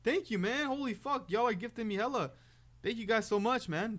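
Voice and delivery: high, loud hype for a gift; hyped shout. Real, not acted. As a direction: yell with real excitement. Gift alert, huge hype reaction.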